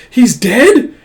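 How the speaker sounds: high, loud, alarmed question; shocked. Acted. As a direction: exclaim it in shock at the body. Shock at seeing the body.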